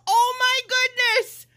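real high-pitched amazement; excited squeal. Real, not acted. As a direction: squeal it in thrilled astonishment. Sudden delight at gift.

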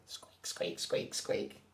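tiny voiceless squeaks; quick little squeaky whispers; squeaking away. A narrator's reading. Little Lion squeaking away, tiny voiceless squeaks. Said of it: "He's still squeaking away".

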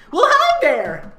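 high, slow, bright-eyed host hello; chirpy greeter voice. Pretend. Host greeting the fanfic audience in a put-on chirpy voice. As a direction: sing out a big fake-friendly welcome. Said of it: "I can't do that for a very long period".

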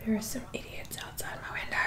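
hushed whisper about the noise outside. Real, not acted. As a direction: whisper it so they can't hear. Noise outside her window.

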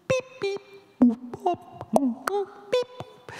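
very high, chirpy synth beeps; electronic beeps. Retold or imitated. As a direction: imitate a toy synthesizer bleeping. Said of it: "it went".

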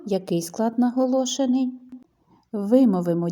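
ordinary talk, nothing marked

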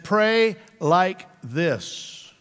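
drawn-out, word by word; slow and deliberate. Shown as a demonstration. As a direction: spell out the instruction very slowly. Stretching out the key verse.

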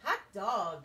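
loud excited cheer; cheering kids. Acted. Kids greet their returning teacher with a loud cheer. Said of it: "cried the kids".

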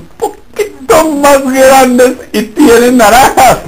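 high, slow, half-crying whine breaking into a laugh; mock whining plea. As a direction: play the disappointed believer almost in tears. Man whining about small seed.

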